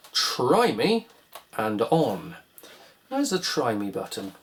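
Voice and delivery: ordinary delivery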